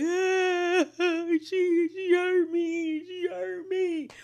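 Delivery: hammed-up high wail, slowly drawn out; mock crying. Mocking the friend making a scene at the party, exaggerated tearful wail. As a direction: imitate a whiny sobbing fit, stretched and high. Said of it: "Ayan starts crying".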